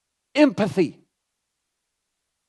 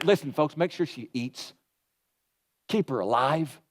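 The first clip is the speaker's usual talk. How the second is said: soft Jesus instruction; 7 semitones lower, 8 dB quieter than usual. Retold or imitated. Jesus voiced instructing the family.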